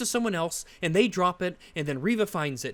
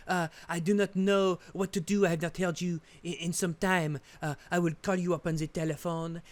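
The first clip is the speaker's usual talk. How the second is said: put-on hammy French accent, trailing into a softer, hesitant phone message; 2 semitones lower, 4 dB quieter than usual. Worried French Resistance member phoning Pierre, mock French accent. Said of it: "this is how they talk".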